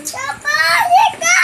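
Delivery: high loud call across the house. Real, not acted. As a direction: call out to Papa. Kid calling father from afar.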